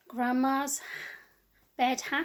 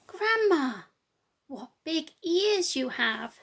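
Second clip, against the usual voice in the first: higher, slow, drawn-out wonder; wondering little girl; 4 semitones higher, 6 dB louder, slower than usual. Acted. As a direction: marvel at it slowly like a child.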